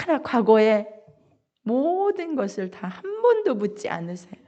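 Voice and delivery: ordinary delivery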